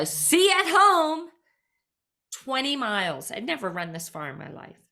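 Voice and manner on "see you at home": high, loud, drawn-out holler; mock shout, stretched out slow; yelled. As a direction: yell it like a coach from a car window. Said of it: "yells out the window".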